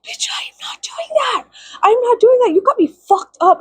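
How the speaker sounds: high, outraged squeal with a whispered snap; indignant high voice. Retold or imitated. To the cheating game boyfriend, high outraged refusal. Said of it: "And I was like".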